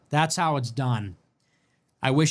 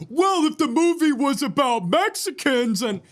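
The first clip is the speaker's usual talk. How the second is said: mocking whiny chatter; 10 semitones higher than usual. Mimicking a chatter's complaint in a whiny high voice. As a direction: whine it like an offended commenter.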